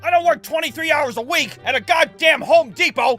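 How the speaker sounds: high, loud grumpy rant; grumpy stepdad. Retold or imitated. Stepdad scolding kids for eating his food, high and loud.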